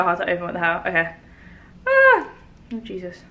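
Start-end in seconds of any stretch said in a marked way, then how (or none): 1.9-2.3 sharp high yelp; pained yelp. Real, not acted. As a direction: yelp as the heat hits. First taste of hot sauce.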